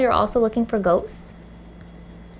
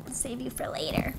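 ordinary talk, nothing marked

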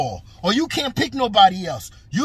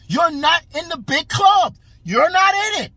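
raised, louder emphatic shout; 8 semitones higher, 4 dB louder than usual. Real, not acted. Ranting to Crawford, loud and emphatic. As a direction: shout it at the fighter.

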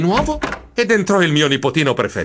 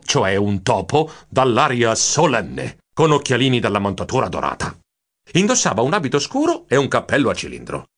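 ordinary delivery

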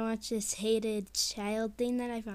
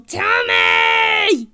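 sudden high, very loud shout; demanding yell; 9 semitones higher, 14 dB louder than usual. Acted. Kid from another dimension demands the way back, yelling.

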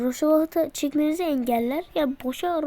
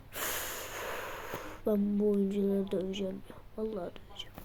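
fed-up whisper; 6 semitones lower, 10 dB quieter than usual. Real, not acted. Fed up with his players.

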